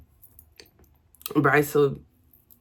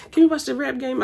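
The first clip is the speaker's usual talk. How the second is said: put-on little kid asking; 9 semitones higher, faster than usual. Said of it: "he'd be like".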